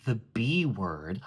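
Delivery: slow, hesitant "the B word". Shown as a demonstration. Coach showing a prim girl finally saying a bad word, slow and hesitant. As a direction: drag out "the B word" as if it hurts to say. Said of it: "she should probably hesitate to say that word".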